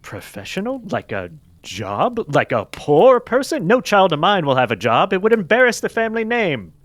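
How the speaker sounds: haughty rich father scoffing; snobbish father. Acted.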